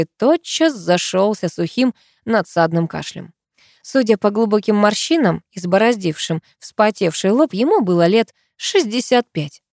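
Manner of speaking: ordinary delivery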